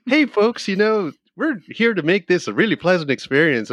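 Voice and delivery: high, bright singsong greeting to the passengers; chirpy welcome like a whale-watch host; cheery tour guide. Retold or imitated. Whale boat guide greeting passengers, high chirpy welcome.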